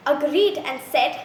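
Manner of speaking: ordinary delivery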